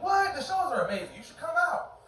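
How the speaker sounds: high-pitched, avid insistence. Retold or imitated. Comic urging his ex to come to his shows, avid. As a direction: push the invitation eagerly, voice jumping up. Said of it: "like, avid about it".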